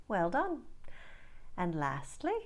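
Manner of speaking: ordinary talk, nothing marked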